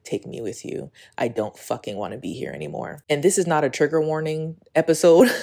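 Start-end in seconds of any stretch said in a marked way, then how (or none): 0.0-3.0 soft, breathless, near-whispered plea; tearful whispered plea. Retold or imitated. Retelling her plea to her grandparents in a tearful whisper. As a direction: plead through held-back tears, barely voiced. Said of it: "sobbing mess".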